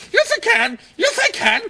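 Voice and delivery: very high, squeaky cartoon voice; high squeaky triumphant retort; squeaky hero voice.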